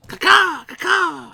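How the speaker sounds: high, loud bird call caw-caw; loud hawk screech. Pretend. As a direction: shriek like a hawk. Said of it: "I'm a hawk".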